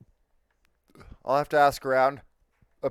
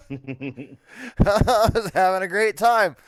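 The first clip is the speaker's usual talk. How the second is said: laughing; 5 semitones higher, 5 dB louder, slower than usual. Real, not acted. Laughing while telling story.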